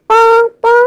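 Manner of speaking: mock car horn beep pau pau.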